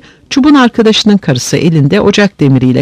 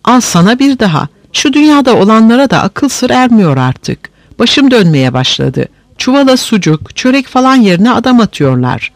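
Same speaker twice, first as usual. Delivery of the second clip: higher-pitched alarmed complaint; mild fearful cry; 7 semitones higher than usual. A narrator's reading. Weaver finds another man in the sack, mildly fearful cry. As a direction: exclaim in understated alarm, pitch raised. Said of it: "Korku içinde bağırdı dokumacı".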